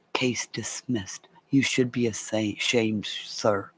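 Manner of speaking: mock the judge's quiet scolding; quiet stern judge. The judge dismissing the case, quietly scolding the man.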